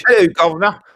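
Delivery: high, loud Cockney call; hammed-up Cockney greeting; Cockney accent. Shown as a demonstration. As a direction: holler it like a cartoon Cockney. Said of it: "Yeah, Cockney".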